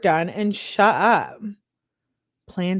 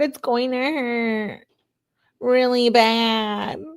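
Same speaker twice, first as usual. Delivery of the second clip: drawn-out, higher-pitched imitation whine; mock whining; 4 semitones higher, much slower than usual. Scared girlfriend texting her boyfriend about the needle, mock whining. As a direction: drag each word out like a whiny, scared girlfriend.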